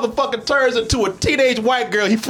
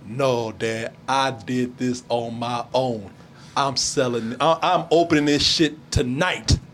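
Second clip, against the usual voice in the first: defiant son; 8 semitones lower, 4 dB quieter, slower than usual. Retold or imitated. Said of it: "the son gets in his face and said".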